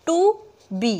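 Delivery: "to" and "be" as two separate beats; syllable by syllable. Shown as a demonstration. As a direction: pause between "to" and "be". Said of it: "उसमें दो syllable होना चाहिए".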